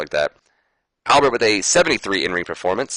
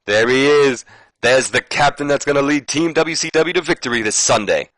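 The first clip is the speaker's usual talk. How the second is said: put-on higher, brash Nature Boy swagger; hammed-up Flair hype; Ric Flair voice; 6 semitones higher than usual. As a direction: play the cocky veteran greeting his captain. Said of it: "And then Rick says".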